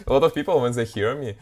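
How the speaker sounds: normal talking voice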